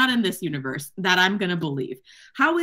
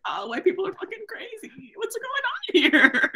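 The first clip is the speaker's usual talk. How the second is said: very high, squeaky put-on incredulity; mock bewilderment; 12 semitones higher than usual. Reacting to the crazy book scene, mock bewilderment. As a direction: let the voice shoot way up in comic disbelief.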